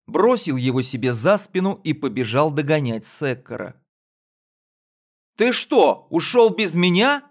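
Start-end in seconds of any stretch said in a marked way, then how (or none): 5.4-7.2 put-on high, loud boy's protest; indignant boy. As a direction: shout after him hurt. Boy calls after drover.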